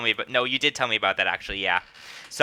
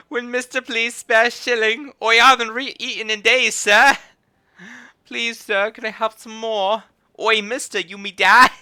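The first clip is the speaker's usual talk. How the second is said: high-pitched mock Victorian urchin; Victorian child; 9 semitones higher, 5 dB louder than usual. Begging a mister for a shilling, mock urchin voice. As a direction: beg in a hammed-up, high child's voice. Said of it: "Victorian child".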